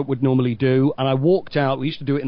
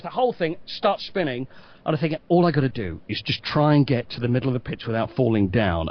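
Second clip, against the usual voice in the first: ordinary talk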